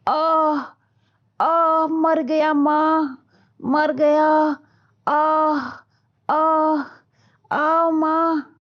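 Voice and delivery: high, slow pained wails; crying in pain. Acted. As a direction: wail from a bellyache. Son in stomach pain.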